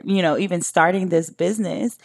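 ordinary talk, nothing marked

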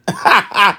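short high-pitched chuckle; laugh. Real, not acted. Podcaster tickled by the basketball action, laughing. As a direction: laugh briefly in high bursts. Laughs at own excited talk.

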